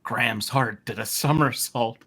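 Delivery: ordinary talk, nothing marked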